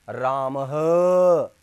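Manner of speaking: राम ह with a breathy h on the end, drawn out very slowly; visarga on ram. Shown as a demonstration. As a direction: stretch राम and push out a loud ह from the throat. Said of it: "अब मैं इसके लगा देता हूँ". (राम ह, विसर्ग के साथ धीरे धीरे)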